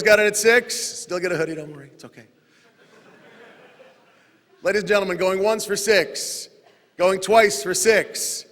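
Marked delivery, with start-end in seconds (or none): 4.6-8.4 slow, drawn-out auction call; auctioneer. Real, not acted. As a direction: call the bids slowly like an auctioneer. Auctioneer calling the bids.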